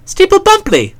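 high breezy prompt; chatty Bertie. Acted. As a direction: toss it off lightly.

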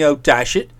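"Oh, dash it" thrown back low and quick; low mocking echo of "Oh, dash it"; scornful quoting. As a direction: quote "Oh, dash it" back dismissively. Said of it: "It's no good saying".

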